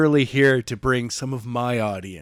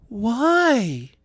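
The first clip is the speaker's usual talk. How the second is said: shocked why; 10 semitones higher than usual. Acted. Jenna's shocked question.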